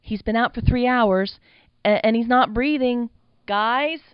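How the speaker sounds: high, loud alarm at a friend not breathing; panicked. A narrator's reading.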